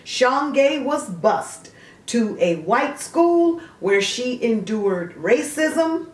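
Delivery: a bright encyclopedia read; reading a biography entry aloud; reading aloud from Wikipedia. Acted.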